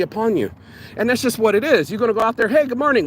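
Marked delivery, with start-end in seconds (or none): 2.5-3.1 high, put-on morning hello; mock cheery greeting. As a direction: call it out like a bright greeting to strangers. Acted greeting to strangers.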